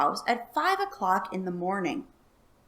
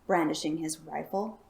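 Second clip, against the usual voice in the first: ordinary delivery, nothing marked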